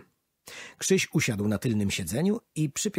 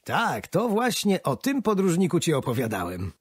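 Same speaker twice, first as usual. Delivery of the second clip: a touch of glad pride, raised; pleased answer; 7 semitones higher, 4 dB louder than usual. Acted. Grandpa answers his grandson, gladly pleased. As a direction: answer happily, pleased he remembered. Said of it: "ucieszył się dziadek".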